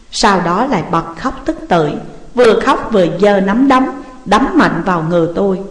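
plain reading voice

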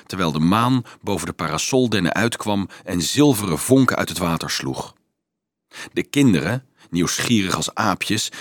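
ordinary delivery

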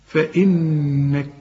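فإنك drawn out, the doubled nun held; very slow. Shown as a demonstration. Teacher models the stop for the student. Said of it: "ده عند الوقف".

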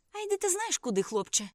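sudden loud high angry burst; outburst. A narrator's reading. Girl lashing out at the narrator, angry shout. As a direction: explode at the boy. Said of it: "вибухнула вона".

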